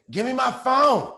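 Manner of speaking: high, loud frantic yelling for a phone; desperate shouting from across the room; yelling in the background. Retold or imitated. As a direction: shout it like she's fighting to grab it back. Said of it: "all I hear in the back is".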